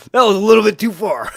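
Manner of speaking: loud, fast, laughing; laughing shout. Real, not acted. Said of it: "laughing at own joke".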